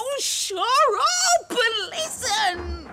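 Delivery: slow, loud, high plea; desperate shout. Acted. Mother shouting at the landlady to listen, slow loud plea. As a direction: make her stop and hear you. Quarrel in the pub.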